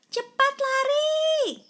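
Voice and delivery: high, loud, drawn-out cry to run; urgent shout. A narrator's reading. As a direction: yell at friends to flee. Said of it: "seru Yaki lagi". (teriakan tinggi, keras dan panjang)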